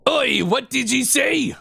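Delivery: angry pirate. Acted. Pirate friend overhearing an insult, indignant pirate shout. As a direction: bristle at the insult.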